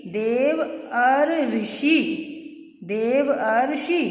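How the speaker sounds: slow, part by part: देव अर ऋषि; slow syllable breakdown. Shown as a demonstration. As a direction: spell it out slowly for students. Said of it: "आप बोलते समय ध्यानपूर्वक सुनिएगा". (धीरे धीरे टुकड़ों में बोलें)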